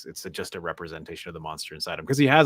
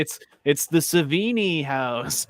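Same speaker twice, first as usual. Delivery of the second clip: slow, higher mock-proud filmmaker voice; amateur filmmaker; 8 semitones higher, 3 dB louder, much slower than usual. Mocking amateur filmmakers naming a reference, in a slow, hammed-up voice. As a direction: drawl it out like a smug indie director. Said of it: "filmmakers do to be like".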